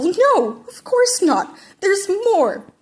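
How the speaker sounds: high, loud incredulous reply; near-squeak of disbelief; exasperated. Acted. Teacher exasperated.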